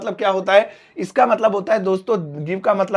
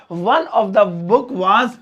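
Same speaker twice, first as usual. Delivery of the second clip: drawn out, word by word; slow model sentence; much slower than usual. Shown as a demonstration. Teacher reads example slowly to students. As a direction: spell each word out slowly. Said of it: "one of the book was the best".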